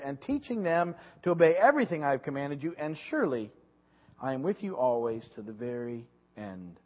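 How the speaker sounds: loud, deliberate Bible reading; scripture reading. Acted. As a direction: proclaim the passage to the congregation. Reads Scripture aloud.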